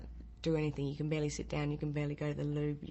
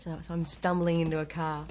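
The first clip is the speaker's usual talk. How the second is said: normal talking voice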